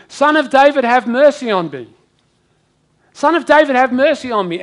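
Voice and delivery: loud, high plea for mercy; calling out. Acted. Blind man calling out loudly to Jesus for mercy. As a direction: cry out to Jesus from the roadside.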